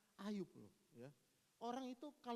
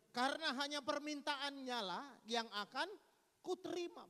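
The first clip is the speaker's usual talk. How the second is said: higher, louder proclaiming; God's declaration; 7 semitones higher, 6 dB louder than usual. Acted. Preacher voicing God accepting Job's prayer. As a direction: announce it solemnly.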